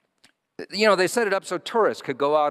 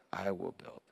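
soft echo; 4 semitones lower, 12 dB quieter than usual. Acted. Softer echo of the repeat.

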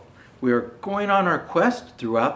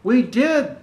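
a touch louder, higher cheer; excited children's shout; 8 semitones higher, 6 dB louder than usual. Acted. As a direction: proudly call out to the parents. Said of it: "the children shout".

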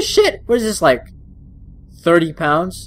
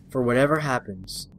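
normal talking voice

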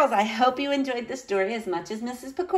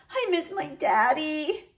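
sniffly; 8 semitones higher, 3 dB quieter than usual. A narrator's reading. Niece missing her daddy, softly sniffly. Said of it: "Minuet sniffed".

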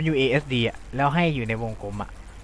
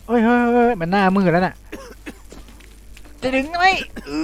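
rising higher, worried hurry; urging the climb on in alarm; anxious excitement; 7 semitones higher than usual. Real, not acted. Player panicking as the boy nearly blacks out, rising alarm. Character fainting in chimney.

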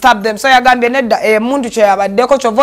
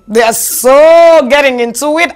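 ordinary talk, nothing marked

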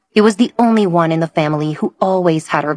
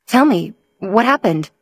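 anxious; 4 semitones higher than usual. A narrator's reading. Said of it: "Emily said anxiously".

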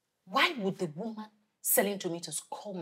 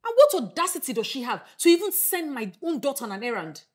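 indignant outrage; 5 semitones higher, 6 dB louder than usual. Acted. Mother outraged at the trader.

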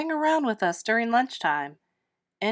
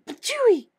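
higher, harder spit of disgust; spitting; 13 semitones higher, 5 dB louder than usual. Acted. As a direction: spit even harder, really grossed out.

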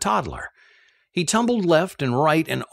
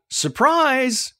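high, bright call of surprise; 11 semitones higher than usual. Retold or imitated. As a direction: hold the bundle up and sing it out, much higher. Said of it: "I held up the bundle and called".

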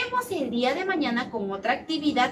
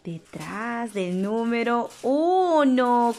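slow drawn-out reveal; much slower than usual. Acted. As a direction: stretch the answer out slowly.

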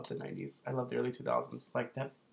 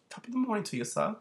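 a jokey, higher put-on British greeting; mock British accent; 9 semitones higher than usual. Vlogger greeting viewers in a joking mock British accent. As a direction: tip the hat in a hammy British accent. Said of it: "yes, I have a British accent".

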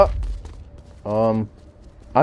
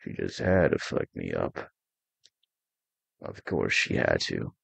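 very soft, much lower voice; low grumble; 14 semitones lower, 14 dB quieter than usual. Real, not acted. Player blaming someone to himself, low quiet grumble. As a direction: grumble resignedly under your breath. Grumbles to self over blame.

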